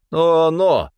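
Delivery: high, indignant character voice; indignant protest. A narrator's reading. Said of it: "возмутился Стефан". (возмущённо, высоким голосом)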